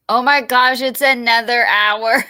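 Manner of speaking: amused shock at the length; surprised disbelief. Real, not acted. Learns video is an hour.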